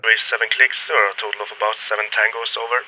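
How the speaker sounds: clipped radio transmission, very loud; loud distorted radio. Shown as a demonstration. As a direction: blast it through a distorted radio. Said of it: "As you can hear, it's really loud".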